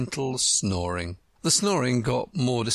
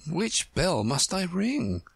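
puzzled; 6 semitones higher, slower than usual. A narrator's reading. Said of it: "very much puzzled".

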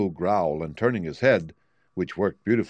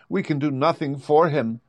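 higher, mildly sorrowful character line; a touch of sadness in a raised voice; understated sadness; 7 semitones higher, 3 dB louder than usual. A narrator's reading. Tin Woodman gives up on the sleeping Lion, mildly sad. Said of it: "said the Tin Woodman sadly".

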